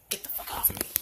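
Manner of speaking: unvoiced, soft curse; whispered grumble. Real, not acted. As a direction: hiss it under your breath. Aside to dog.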